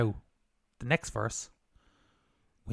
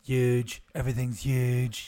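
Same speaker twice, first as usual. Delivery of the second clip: Donald Trump impression; 6 semitones lower, slower than usual. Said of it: "Donald Trump".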